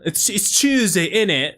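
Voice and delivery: mock Cockney "innit"; mock British accent. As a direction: play a Londoner for the chat, half in jest. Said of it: "Please do not start World War Three".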